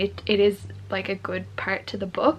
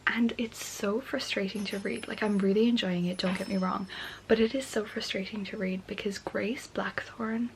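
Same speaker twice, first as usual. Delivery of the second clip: late-night whisper; hushed night-time vlog whisper; 6 dB quieter than usual. Real, not acted. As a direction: whisper so as not to wake anyone.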